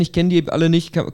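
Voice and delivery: ordinary delivery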